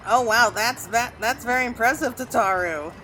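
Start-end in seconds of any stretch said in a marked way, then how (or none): none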